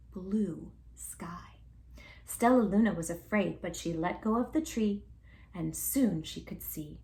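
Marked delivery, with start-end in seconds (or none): 0.1-1.6 whisper. A narrator's reading. 5.5-7.0 whisper. A narrator's reading.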